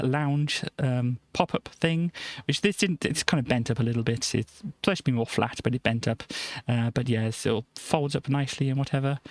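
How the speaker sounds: ordinary talk, nothing marked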